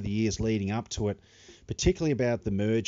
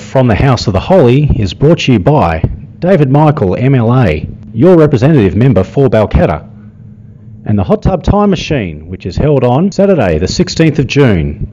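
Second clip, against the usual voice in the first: ad read; 19 dB louder than usual. Acted. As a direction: read it like a radio ad. Sponsor ad read at start.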